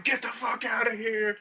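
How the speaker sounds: mock angry yell. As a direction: roar it like swinging a bat. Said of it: "swinging it at people".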